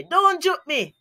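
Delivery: mock a pestered schoolkid; poked child. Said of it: "the poked child will say".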